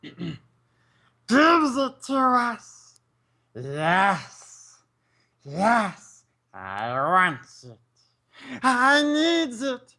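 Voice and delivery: high, slow, pleading creature voice; Gollum impression. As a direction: beg in Gollum's high, strained voice. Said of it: "I'm gonna do my golem impression".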